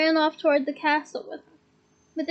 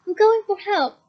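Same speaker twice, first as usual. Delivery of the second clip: loud, higher call; shouting; 5 semitones higher, 4 dB louder than usual. A narrator's reading. Harry calling back to friends as he runs, shouting. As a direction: yell it as you start running.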